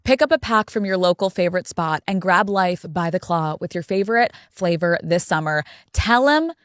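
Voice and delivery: upbeat sponsor read over music; podcast host reading a seltzer ad to fans, peppy. Acted. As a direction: sell it to the listeners, breezy and bubbly.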